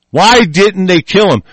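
usual speaking voice

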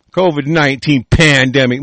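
ordinary talk, nothing marked

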